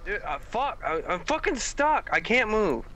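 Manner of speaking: high, panicked whine. Real, not acted. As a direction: panic as you get stuck. Stuck in game, panicking.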